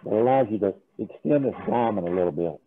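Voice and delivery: muffled behind a respirator mask; talking through a full face respirator. Real, not acted. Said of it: "hear me through this respirator".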